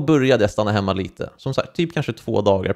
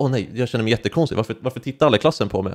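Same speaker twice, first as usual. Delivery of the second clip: fast, flustered self-talk; anxious inner voice; faster than usual. Retold or imitated. Self-conscious boy in class after removing cap, fast and flustered. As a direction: rush it like self-conscious panic. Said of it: "jag bara".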